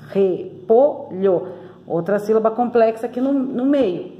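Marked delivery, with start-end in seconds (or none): 0.1-1.6 REPOLHO slowly split as re-po-lho; Repolho, slow, with gaps between syllables. Shown as a demonstration. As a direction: sound out Repolho in three separate syllables. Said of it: "repolho tem três sílabas".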